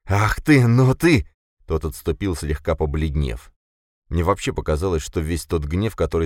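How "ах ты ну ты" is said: loud, high, sputtering outrage; indignant and loud; speechless rage. A narrator's reading. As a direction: burst out, lost for words. Boris enraged, lost for words.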